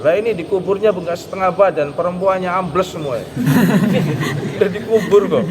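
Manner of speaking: laughing through the joke; giggling while telling the joke. Real, not acted. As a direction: chuckle out the punchline. Preacher jokes about the burial.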